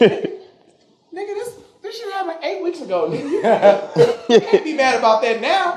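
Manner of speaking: high pitched, laughing plea; laughing protest. Retold or imitated. Son pleading with angry dad, laughing and high. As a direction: whine it through laughter.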